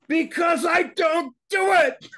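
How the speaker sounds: high, loud, drawn-out shout; slow, hammered high yell; emphatic shout. Acted. Emphatic answer to own question.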